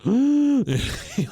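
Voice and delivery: a sharp high sucking gasp; mock gasp of shock; imitated gasp. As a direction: suck the air in like a shocked kid. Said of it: "it sucks in all the air".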